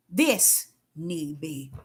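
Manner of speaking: calm and deliberate divine answer; hushed, unhurried reply to Deborah; God's gentle voice. Retold or imitated. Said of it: "He have to tell me".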